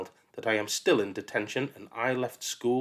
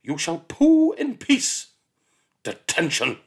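much higher, louder, slow shout; 7 semitones higher, 8 dB louder, slower than usual. Acted. Miss Seeth shouts at the humming child, bellowing. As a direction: bellow through the toilet door. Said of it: "Before shouting".

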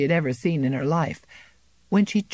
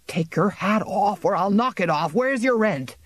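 threatening bailiff; 4 semitones higher than usual. A narrator's reading. Bailiff threatens defiant farmer.

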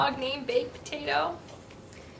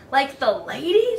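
hopeful, lilting question; 5 dB louder than usual. A narrator's reading. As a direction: ask it hopefully, lifting at the end. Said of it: "the dog asked hopefully".

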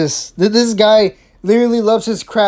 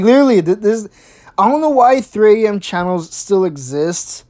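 ordinary talk

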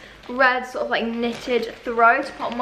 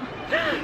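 sharp high gasp of delight; excited gasp; 7 dB quieter than usual. Real, not acted. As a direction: gasp like you just heard great news. Gasp at meatball news.

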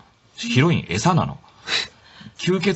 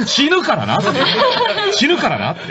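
laughing it out; 4 dB louder than usual. Real, not acted. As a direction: say it through real laughter. Laughing at the scene.

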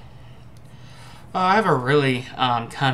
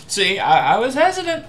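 high, laughing through the words; 9 semitones higher, 7 dB louder than usual. Real, not acted. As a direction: laugh while admitting it. Laughing after tasting.

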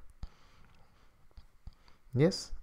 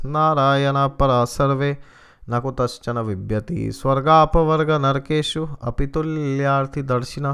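louder, chanted Sanskrit verse; verse recitation; 5 dB louder than usual. Acted. The preacher quoting Krishna in the Gita, chanted recitation. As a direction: intone the shloka with weight.

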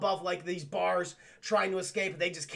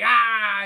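exasperated groan; 2 semitones higher, 11 dB louder than usual. Retold or imitated. Evie pushes past husband.

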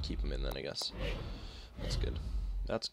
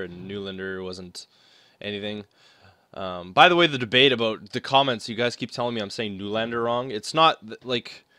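ordinary delivery

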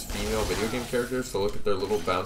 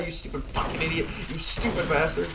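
high-pitched, strained cursing; worked-up, high gloating fury; gamer rage; 8 semitones higher than usual. Real, not acted. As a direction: curse the enemy in a high frenzy. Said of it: "instinctive rage in your voice".

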